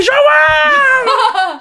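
very high, loud, long holler; hammed-up screaming of a name; retold scream. Injured caller screaming for a relative by phone, hammed-up holler. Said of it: "eu tava gritando".

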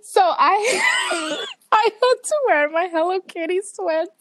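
half laughing, half wailing at the memory; laugh-crying. Real, not acted. Laughing about the embarrassment.

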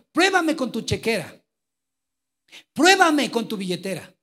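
high, loud challenge, pruébame hammered; preacher voicing God's loud dare. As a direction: shout the dare from heaven.

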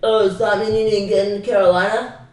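exaggerated snooty-guy imitation; mocking snob. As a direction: drag out the fake question to ridicule the snob. Mimics snob at college party.

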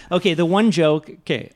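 normal talking voice

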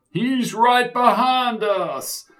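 high, slow panicked shout; panicked cry. A narrator's reading. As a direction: cry out the warning in alarm. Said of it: "cried Sid".

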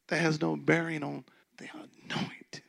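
unvoiced whispered aside; hushed whisper. Real, not acted. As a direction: whisper it as a secret truth. Whispered point.

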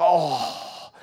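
mock strained "oh" of held-back tears; imitated ugly trying not to cry. Preacher imitates wife trying not to cry, strained. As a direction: act out fighting back tears, loudly. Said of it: "ugly trying not to cry".

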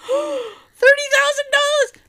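high, slow stunned question; high drawn-out shocked echo of the number; shocked disbelief. Retold or imitated. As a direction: gasp it out in disbelief. Shocked at the settlement amount.

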